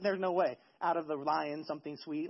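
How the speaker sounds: low, soft, dismissive aside; unworried confidence. Acted. Samson sure nobody will guess his riddle, quietly confident. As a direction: shrug it off quietly, voice sinking. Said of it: "But he's confident. He's not worried about it".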